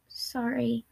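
soft, meek apology; barely got out. A narrator's reading. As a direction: manage only a small apology. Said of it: "seemingly the only thing he could get out".

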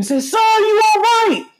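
high, slow, loud call; screaming. Retold or imitated. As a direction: yell it out in alarm. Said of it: "I was already screaming".

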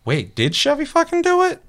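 high, startled double-take; suddenly doubt your own eyes; doubting shock. Retold or imitated. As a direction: Josh second-guessing what he saw, high and startled. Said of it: "he's gonna go".

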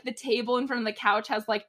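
ordinary talk

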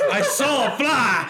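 slow, high put-on voice; lightly hammed-up innocent excuse; mock innocent gunman. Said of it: "standing there with a smoking Beretta".

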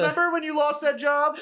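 high, sneering taunt; mocking villain. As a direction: rub it in like a bully.

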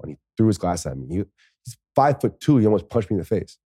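plain reading voice

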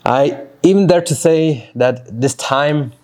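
ordinary talk, nothing marked

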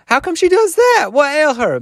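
shocked outcry. A narrator's reading. Listeners hearing of the choking, shocked outcry. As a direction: shriek the questions in disbelief.

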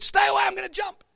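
high, loud shouted warning; shouting. Retold or imitated. Woman on bridge yelling back at motorist. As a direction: shout her away, high.

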